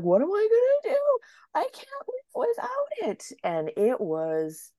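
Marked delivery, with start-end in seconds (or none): 0.0-3.2 very high, wailing whimper; mock despair. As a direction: whine it in hammed-up despair. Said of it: "And I was like".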